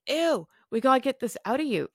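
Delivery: high, grossed-out nurse voice; disgusted student nurse. Retold or imitated. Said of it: "she's like".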